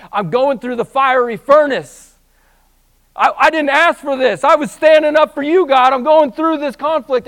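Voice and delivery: high, loud impassioned preaching cry; preaching at full force. Acted. Preacher crying out a sufferer's complaint to God, full force preaching.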